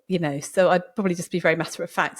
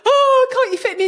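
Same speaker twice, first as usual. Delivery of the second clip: tearful plea; 6 dB louder than usual. Retold or imitated. Tearful bride begs the beautician.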